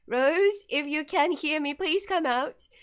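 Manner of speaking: acted, pleading call. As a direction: call anxiously into an empty house. Calling to missing friend.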